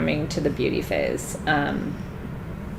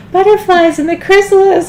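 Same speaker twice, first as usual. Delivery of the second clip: dreamy idealist; 11 semitones higher, 12 dB louder than usual. Retold or imitated. Her dreamy view of butterflies.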